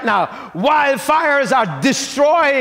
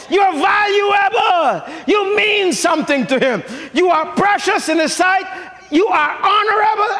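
preaching; 5 semitones higher than usual. Acted. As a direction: proclaim with fervor. Preaching at full voice.

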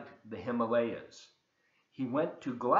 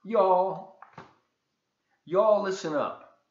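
loud call for attention; rally the room to listen; 7 semitones higher, 8 dB louder, slower than usual. Real, not acted. Storyteller calling the children to listen, loud.

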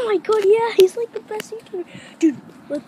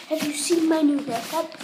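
usual speaking voice

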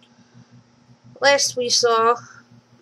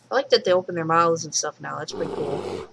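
normal talking voice

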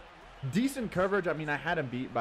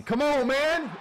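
high, loud outburst; heated shout; 7 dB louder, slower than usual. Real, not acted. Gamer yelling mid-play, heated shout.

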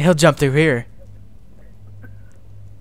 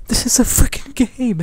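ordinary delivery

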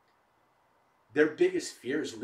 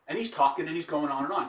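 normal talking voice

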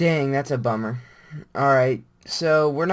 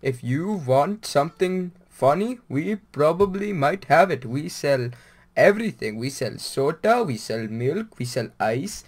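hammed-up Indian accent, lilting rises on the list; mock Indian shopkeeper; 2 semitones higher, 3 dB louder than usual. Gamer imitates an Indian convenience-store clerk in mock accent. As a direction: rattle off the store's goods as a caricatured Indian clerk. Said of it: "They're like".

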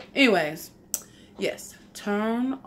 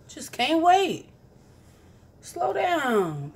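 mock scolding; 8 semitones higher, slower than usual. Vlogger teasing viewers who came back early, mock scolding.

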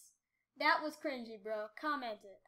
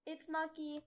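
ordinary talk, nothing marked

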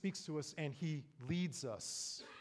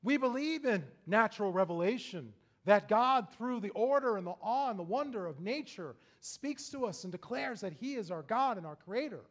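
higher, louder pulpit delivery; forceful preaching; 7 semitones higher, 10 dB louder than usual. Real, not acted. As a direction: proclaim it to the congregation with rolling emphasis.

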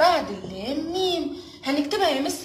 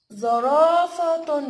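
very slow, louder "زرافة", syllables drawn out; slow sounding out; 3 semitones higher, 5 dB louder, much slower than usual. Shown as a demonstration. Teacher presenting a word to kids, stretched. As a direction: stretch the word for children to hear its parts. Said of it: "ايه اللي ناقص".

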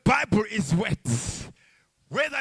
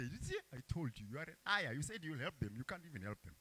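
mock accuser voice; 9 semitones lower, 21 dB quieter, faster than usual. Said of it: "Satan can say".

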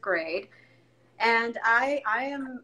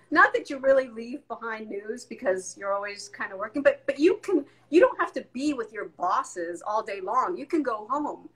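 normal talking voice

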